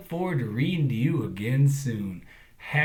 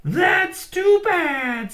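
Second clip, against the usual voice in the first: high, slow crow voice; squawking crow; 9 dB louder, much slower than usual. A narrator's reading. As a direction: drag it out like a mangy old crow. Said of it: "squawked the mangly old crow".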